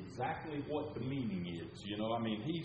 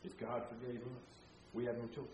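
much softer, reflective restatement; softer repeat; 7 dB quieter than usual. Shown as a demonstration. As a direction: say it again gently. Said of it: "we talked about this last time".

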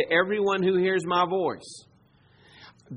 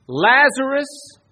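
commanding call; 6 semitones higher, 8 dB louder, slower than usual. Acted. Jesus calls the dead Lazarus out of the tomb, loud. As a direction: call the name out loud and high.

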